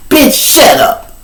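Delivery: high slow taunting bitch shut up; mock jail taunt; mocking inmate. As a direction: drag out the taunt.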